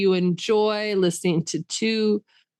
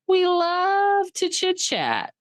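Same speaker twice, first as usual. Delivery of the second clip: high sing-song; 12 semitones higher, slower than usual. Acted. Sing-song sign-off line.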